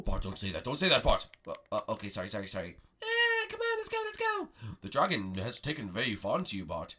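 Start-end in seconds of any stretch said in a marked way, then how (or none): 4.8-6.9 wise master voice. Acted. Master Prego speaks.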